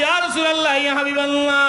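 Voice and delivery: high, loud chanted cry; calling out. Acted. Woman appealing to the Prophet, calling out. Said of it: "মহিলা ডাক দিয়ে বলে".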